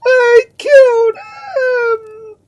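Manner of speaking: extremely high, long-drawn wailing scream; desperate scream. A narrator's reading. Duke dragged from the corpse, screaming. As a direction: scream it, stretching the last word. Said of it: "He was still clinging to the corpse and screaming".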